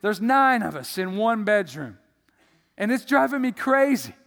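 high, loud whiny complaint to the rabbi; loud complaining. Retold or imitated. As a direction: complain loudly, at the end of your rope. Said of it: "and he's complaining".

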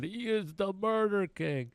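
put-on high, slow accented chant; Brazilian fighter's voice. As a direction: mimic the fighter, lifted and drawn out. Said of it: "He's going".

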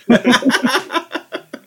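high giggle; laughing. Real, not acted. Laugh after joke.

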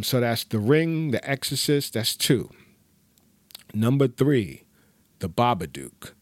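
ordinary talk, nothing marked

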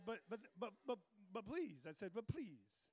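hammed-up flustered pleading; stammering mock plea. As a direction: sputter in exaggerated disbelief. Stammering retold plea, pitch swoops.

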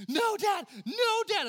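put-on high, loud child shout; kids protesting. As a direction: squeal like a kid escaping a hug. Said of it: "They're like".

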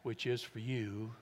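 slow, low and soft, with long pauses; solemn, tender. Acted. Preacher voicing Jesus at the Last Supper, solemn and soft. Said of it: "what was the inflection when he said".

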